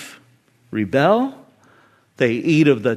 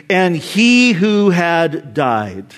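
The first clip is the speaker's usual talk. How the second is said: slow reading; much slower than usual. Shown as a demonstration. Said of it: "Catch that again".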